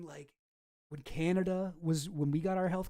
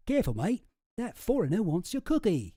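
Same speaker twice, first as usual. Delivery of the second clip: higher, louder, hammed-up Aussie accent; put-on Australian twang, pitched up; bad Australian accent; 4 semitones higher, 6 dB louder than usual. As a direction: attempt an Australian accent, badly and loudly. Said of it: "Can't do an Australian accent".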